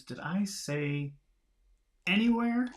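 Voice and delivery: slow, then a high anywhere; second thoughts, pitch leaps up at the end; doubtful surprise. Acted. Cuthbert having doubts.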